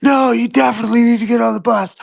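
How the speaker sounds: high, fast mock doom panic; hammed-up doomsayer insisting the world ends; panicky alarmist. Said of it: "And they're like".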